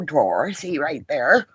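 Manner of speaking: normal talking voice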